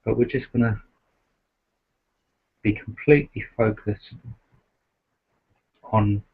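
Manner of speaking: soft, unhurried meditation voice with long pauses; guided meditation. Acted. Host guiding the group to rest in awareness, softly meditative. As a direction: gently settle listeners into stillness, leaving space between phrases.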